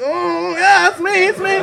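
high, put-on eager agreement; fake recognition. Pretending to know everyone, in high fake eagerness. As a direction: play along too brightly. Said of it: "I'm like".

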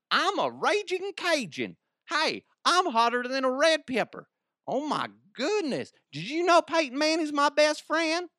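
high-pitched, silly non-Cajun caricature; absurdly high put-on voice, nothing like Cajun; Brandon Stokely. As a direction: squeal it as a ridiculous mock Cajun. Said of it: "the voice for Brandon Stokely is not Cajun at all".

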